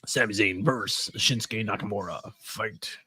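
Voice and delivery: low, growled fighting-game announcer call; lightly voiced Mortal Kombat announcer. Host mimicking a Mortal Kombat versus screen, mock announcer.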